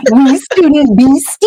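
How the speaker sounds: high, sing-song taunt; teasing Peeves. Retold or imitated. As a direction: tease the unseen intruder. Teller voices Peeves, laughing.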